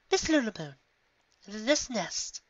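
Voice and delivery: high, measured prim voice; prim lady voice. Acted. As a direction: pause before judging. Rarity judging.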